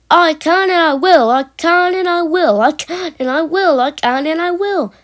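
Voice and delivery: high, determined rhythmic chant; puffing engine. A narrator's reading. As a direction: start loud and chug on, easing off. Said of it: "puffed James".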